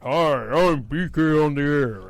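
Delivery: lightly voiced deep radio announcer; B K's baritone voice. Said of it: "the baritone deep".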